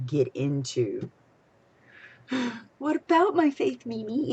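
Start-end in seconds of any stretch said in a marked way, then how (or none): 2.3-4.3 high, excited coo; delighted gasp. Real, not acted. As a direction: gasp and gush over it. Gasps at seeing the next story.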